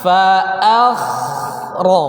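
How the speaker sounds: very slow "Fa akhra", a thin, kh thick; slow model fa akhra. Shown as a demonstration. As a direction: spell out each sound slowly. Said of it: "Jadi gimana?".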